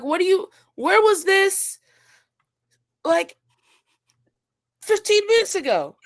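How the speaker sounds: exasperated outburst. Retold or imitated. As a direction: throw the question up in disbelief. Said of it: "And I'm like".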